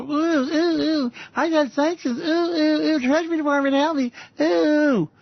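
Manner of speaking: high, whimpering mock voice with a cry; whiny Donald Trump. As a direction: whine like a scared kid begging for help. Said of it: "Donald Trump is gonna run up there and go".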